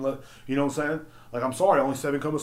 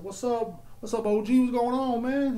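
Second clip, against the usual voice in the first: high, cheery retold greeting; lightly hammed-up cheerful hello; friendly greeting; 9 semitones higher than usual. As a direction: greet him brightly, pitched up. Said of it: "I said".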